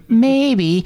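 put-on high, small child's voice; a nervous kid admitting guilt; timid boy. Boy answering the grandpa.

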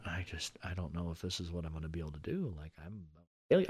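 low, very soft, defeated murmur; retold self-doubt. Struggling student teacher doubting themselves, quietly retold. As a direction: voice your own doubts under your breath. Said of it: "would walk in there going".

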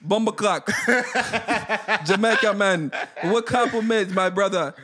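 light put-on Jamaican accent, laughing through it; mock Jamaican accent. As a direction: play a jokey Jamaican man. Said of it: "in a different accent".

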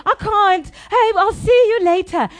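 overdone fake sorry to leave the group; mock apology. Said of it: "And then I was like".

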